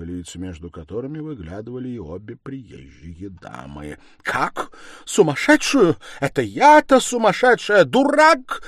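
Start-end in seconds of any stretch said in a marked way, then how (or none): 4.2-8.6 climbing from disbelief to a screech; outraged shriek. A narrator's reading. Stepmother called a madwoman, shrieking back in outrage. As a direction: shriek back, high and loud. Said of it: "взвизгнула Катерина Ивановна".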